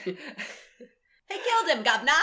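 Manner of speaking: lightly put-on bad Cockney; hint of a hammy Cockney; bad Cockney accent. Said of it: "the bad Cockney accent".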